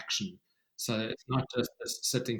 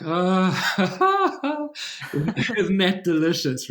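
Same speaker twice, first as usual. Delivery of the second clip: higher, louder, laughing delight; 8 semitones higher, 6 dB louder, much slower than usual. Retold or imitated. Storyteller recalling being moved by the boy, laughing delight. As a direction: gush with laughing joy. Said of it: "I was like".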